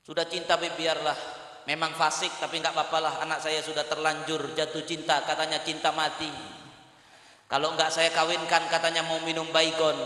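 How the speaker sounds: low, very soft pleading; hushed, low acted surrender; resigned parent. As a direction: give in quietly, acting a helpless parent. Voices parent giving in to daughter.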